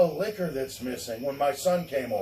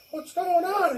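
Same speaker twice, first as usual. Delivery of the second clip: high, playing-dumb question; innocent high voice. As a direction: feign innocence. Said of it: "I was like".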